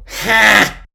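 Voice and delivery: single loud, high "ha"; sharp, barked mock laugh. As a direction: burst out one scornful fake laugh. Scoffs at worst being over.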